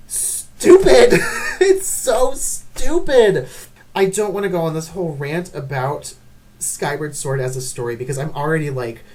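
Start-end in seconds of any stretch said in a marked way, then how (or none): 0.1-3.5 laughing. Real, not acted. Exasperated rant laugh.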